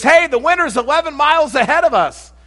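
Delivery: high, loud mock announcement; imitated shout. Runner ahead announcing the winner to the pack, imitated shout. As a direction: call it out like an excited fellow runner. Runner calling out news mid-race.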